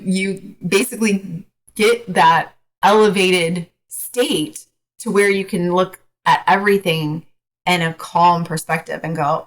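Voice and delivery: ordinary talk, nothing marked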